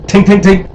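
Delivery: ping out the taps like metal on tile; mock tapping sound. Tapping the ceiling to silence the dog, high mock tinks.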